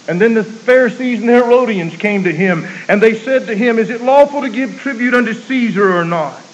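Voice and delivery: high, loud preacher's cadence; preaching at full force. Acted. Preacher telling the congregation of the Pharisees, full-force preaching. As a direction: ring it out to the congregation.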